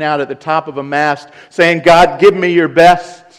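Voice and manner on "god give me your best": loud, higher, brash call to God; brash challenge. Acted. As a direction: fling it up at God boldly. Mocking a boastful challenge.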